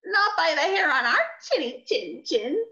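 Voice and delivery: little pigs voice. Acted. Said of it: "said the two little pigs".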